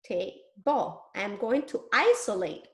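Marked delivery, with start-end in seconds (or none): 0.0-1.0 TABLE as two separate syllables, ta... ble; touch and say. Shown as a demonstration. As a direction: say TABLE one syllable at a time. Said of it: "going to touch and say".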